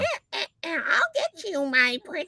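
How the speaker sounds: high cackling witch voice; Wicked Witch voice. Retold or imitated. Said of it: "I used to do the Wicked Witch voice".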